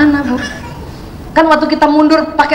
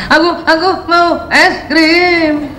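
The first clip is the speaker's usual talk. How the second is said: put-on whiny child; much slower than usual. Shown as a demonstration. Voicing whining child.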